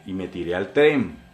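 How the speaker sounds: ordinary delivery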